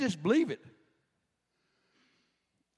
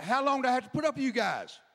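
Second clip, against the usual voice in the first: higher, exasperated modern paraphrase; sarcastic Jesus; 5 semitones higher than usual. Retold or imitated. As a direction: roll your eyes at the disciples. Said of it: "I think he's being a little sarcastic".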